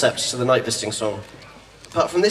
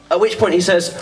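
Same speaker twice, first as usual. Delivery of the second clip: normal talking voice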